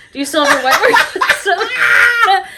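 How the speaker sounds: high, loud question through laughter; laughing shout. Real, not acted. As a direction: burst out laughing mid-question. Repeats question, laughing loudly.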